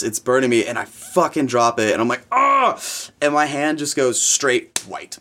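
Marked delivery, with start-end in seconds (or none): 2.3-2.8 sudden high-pitched yelp; short imitated cry of pain; re-enacted pain yelp. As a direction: cry out as if burned, mimicking the moment. Re-enacts dropping the burning pan.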